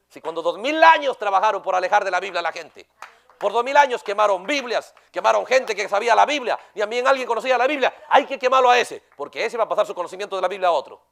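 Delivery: loud, driving sermon voice that builds on each burning; fiery preaching. Acted. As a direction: preach it hard to the congregation. Preaching at full force.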